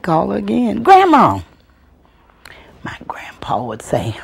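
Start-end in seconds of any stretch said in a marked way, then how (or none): 0.8-1.4 high, loud call across the room; child calling grandma to wake up. Retold or imitated. Little girl calling her sleeping grandma, loud and high. 2.8-4.1 soft unvoiced whisper. A narrator's reading. Storyteller whispering to the audience, soft and breathy. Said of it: "narration dropped to whisper".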